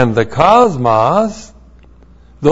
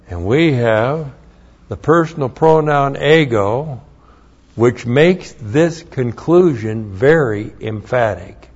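normal talking voice

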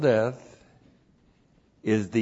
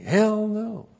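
emphatic denial; 8 semitones higher than usual. Shown as a demonstration.

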